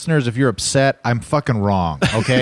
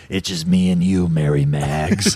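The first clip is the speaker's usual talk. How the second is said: movie Jesus voice; 6 semitones lower than usual. Retold or imitated. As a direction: drop it low and draw it out. Voicing movie Jesus to Mary.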